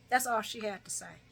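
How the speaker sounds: normal talking voice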